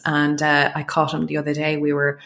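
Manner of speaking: normal talking voice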